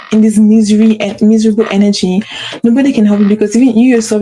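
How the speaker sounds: normal talking voice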